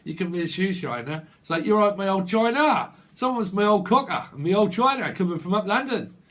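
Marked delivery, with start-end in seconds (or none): 1.6-6.1 a hammed-up Cockney accent, louder; a light Cockney geezer voice in a Cockney accent. Reader imitating a Cockney from London in a Cockney accent. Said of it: "Someone's a cockney".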